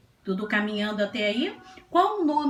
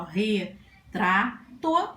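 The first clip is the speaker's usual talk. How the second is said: "retrato" slowly separated into re, tra, to; syllable by syllable; much slower than usual. Shown as a demonstration. Teacher recapping three-syllable words, "retrato" syllable by syllable. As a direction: pause between the three beats of "retrato". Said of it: "palavrinhas com três sílabas".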